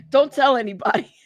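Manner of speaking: giggling through it; laughing. Real, not acted. Laughing confession about drinking.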